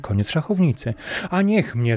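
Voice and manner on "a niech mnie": higher, astonished cry; a king caught off guard by a clever monkey; mild amazed outcry; surprised exclamation. A narrator's reading. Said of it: "zawołał król Indii".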